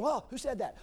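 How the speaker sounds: startled Gideon. Acted. Preacher acts startled Gideon.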